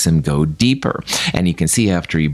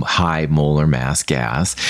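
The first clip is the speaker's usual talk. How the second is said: ordinary talk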